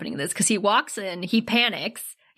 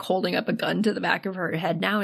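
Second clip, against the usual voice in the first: ordinary talk, nothing marked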